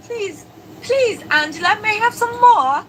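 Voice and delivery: playful, badly faked English accent; put-on English accent. Shown as a demonstration. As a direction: plead like an orphan in a mock English accent. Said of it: "That's my English accent".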